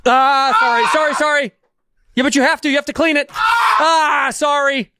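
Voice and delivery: yelping in pain while apologizing; wound being cleaned. Real, not acted.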